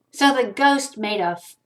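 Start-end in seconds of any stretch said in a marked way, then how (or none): none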